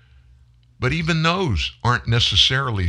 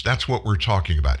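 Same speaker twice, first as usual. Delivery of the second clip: usual speaking voice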